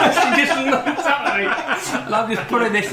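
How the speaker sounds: talking through loud laughter; real helpless laughter while telling; laughing. Real, not acted. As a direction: let the laugh take over the words.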